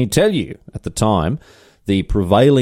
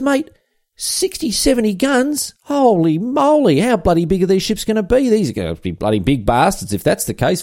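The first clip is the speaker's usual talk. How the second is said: gobsmacked shipwright; 11 semitones higher than usual. Acted. Shipwright reacting to the king's gun count, shrill astonishment. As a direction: let the number knock him sideways. Henrik hears sixty, seventy guns.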